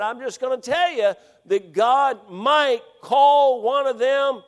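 loud, high, slow preacher build; impassioned preacher cry; preaching at full force. Real, not acted. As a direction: bring it home from the pulpit. Preaching climax.

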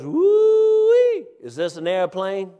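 put-on high whooping hillbilly old man; old country man. Old man gawking at the rich guy's Jaguar, high whooping yokel voice. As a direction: yelp it like an amazed country codger. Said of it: "and he goes".

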